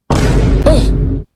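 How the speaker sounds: high breathy mock "oh", well up; imitating a shocked little gasp; startled gasp. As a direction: gasp it out like you just got spooked. Startled victim voiced in retelling.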